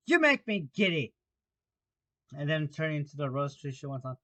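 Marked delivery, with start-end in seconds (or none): 0.1-1.2 loud, high, irritated yell; screamed complaint. Acted. Queen scolding the bowing gardeners, screaming. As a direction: complain at the top of your voice. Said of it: "screamed the Queen".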